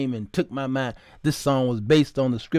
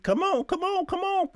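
high, rising come on; excited calling out; 14 semitones higher, 4 dB quieter than usual. Real, not acted. Preacher calling come on to the crowd, way up high. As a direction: urge them on, way up high.

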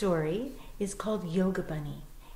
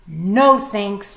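much louder, dismissive no; curt fox refusal; 14 dB louder than usual. Acted. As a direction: brush off the bunny's idea.

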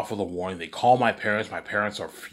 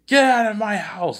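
much higher, louder and slow furious shout; angry shout; 13 semitones higher, 8 dB louder than usual. Acted. Father throws his son out of the house, high slow furious shout. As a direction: throw him out with a high yell.

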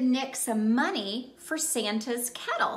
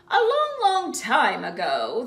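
store Santa; 4 dB louder than usual. Acted. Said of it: "the store Santa continued".

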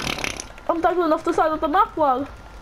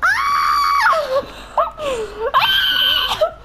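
panicked scream. Real, not acted. Panic in game.

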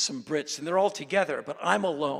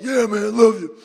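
offhand bro love; 6 semitones higher, 13 dB louder than usual. Shown as a demonstration. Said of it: "So I'll just go like".

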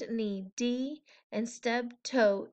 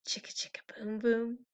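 soft whispered repeat; whispered echo; 7 dB quieter, faster than usual. A narrator's reading. Reader ending the book, whispering. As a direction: hush it like an echo. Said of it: "whispered echo".

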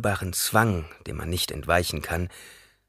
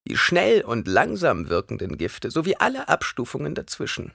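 put-on high old woman voice, lecturing; old crone voice; 6 semitones higher than usual. A narrator's reading. Old woman instructing Paul about poisons, high and knowing.

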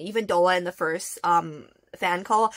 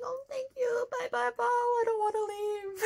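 mock crying goodbye; 14 semitones higher, 4 dB quieter than usual. Fan saying goodbye to idol, mock tearful. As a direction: whimper it in a high, teary mock cry.

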